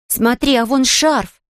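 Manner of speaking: mild high-pitched cry of discovery; girl calling out. A narrator's reading. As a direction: call slowly to point out a find. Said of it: "снова крикнула Марен".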